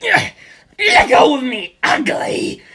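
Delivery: high villain voice, furious; struggling villain. Acted. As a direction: snarl it while grabbed. Antonio struggling.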